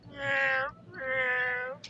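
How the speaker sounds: long imitated meows; drawn-out yowl of a cat in heat. Cat heard from outside the house, yowling meows. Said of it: "You can hear this goddamn cat from outside the house".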